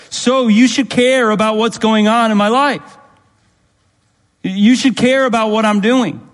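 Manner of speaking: fervent preacher hammering the point to the church; preaching at full force. Acted. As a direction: build it like a pulpit crescendo. Preaching climax on family.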